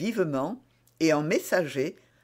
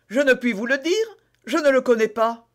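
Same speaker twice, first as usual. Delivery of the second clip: higher-pitched earnest suspect; Bonacieux dépassé; 8 semitones higher, 6 dB louder than usual. Acted. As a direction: answer helplessly in a raised voice. Voices Bonacieux answering. (suspect sincère, voix plus aiguë)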